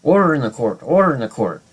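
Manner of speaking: mock judge hammering down for order; judge banging gavel. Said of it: "like a judge in a court, uh, hammering down with his gavel".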